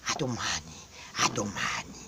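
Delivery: low, soft trailing farewell; fading goodbye. Real, not acted. Signing off to viewers, low soft fading goodbye.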